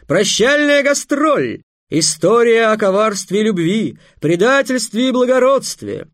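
grand, loud, high showman's announcement; booming circus ringmaster. Acted. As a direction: proclaim it like a fairground barker. Maikhel continues grand announcement. (громогласный конферансье цирка)

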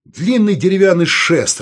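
loud, high, exasperated explanation; irritated. A narrator's reading. Саул explains what pikes are, loud and irritated. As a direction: snap it out loudly, losing patience. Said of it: "раздражённо сказал Саул".

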